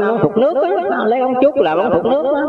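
put-on high, thin old man explaining; sudden high voice of the patient host; character voice. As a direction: pitch way up to play the elder explaining.

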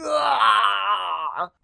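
high pained cry; hammed-up monster scream; dragon death cry. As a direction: cry out as the heart splits. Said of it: "el dragón dice".

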